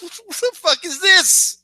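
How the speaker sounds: slow, high-pitched incredulity; stunned disbelief. Retold or imitated. Viewer watching the cliffhanger, high stunned disbelief. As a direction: draw it out in squeaky-high bafflement.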